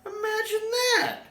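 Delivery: very high, squeaky mock surprise; hammed-up innocent squeak; sly Doctor. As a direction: feign astonishment, cheekily. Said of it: "said the Doctor".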